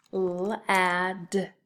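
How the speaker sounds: L-ad with a held l before "ad"; L-ad, first sound stretched. Shown as a demonstration. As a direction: sound out L-ad slowly. Teacher sounds out word for spelling.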